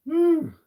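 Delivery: high sharp whistle of breath; relieved whew. Real, not acted. Snake gripping around his neck.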